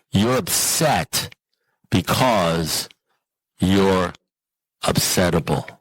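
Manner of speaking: slow, with long pauses between words; imitating a sponsor's measured pronouncement; deliberate verdict. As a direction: deliver the verdict one word at a time. Sponsor's blunt verdict, delivered word by word.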